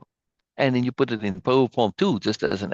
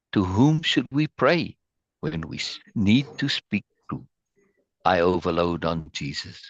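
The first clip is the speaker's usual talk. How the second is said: poem reading; 5 semitones lower than usual. Acted. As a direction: read it reverently, pausing after each phrase. Reads Norma's poem aloud.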